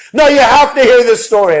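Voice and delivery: high, loud, thrilled phone call; gleeful parents waking grandparents; excited retelling. Retold or imitated. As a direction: burst out with the news.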